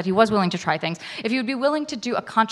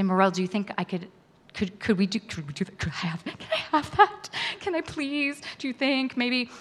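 much softer, hesitant begging; timid pleading; 6 dB quieter than usual. Retold or imitated. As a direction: ask sheepishly, almost afraid to. Timidly asking her friend.

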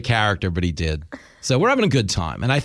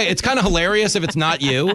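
ordinary delivery

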